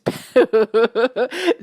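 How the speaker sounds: real breaking-up laugh; helpless laughter. Real, not acted. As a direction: crack up mid-story. Cracks up telling the story.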